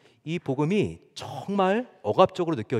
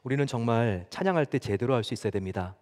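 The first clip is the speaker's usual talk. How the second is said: usual speaking voice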